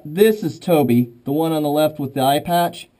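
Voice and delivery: ordinary talk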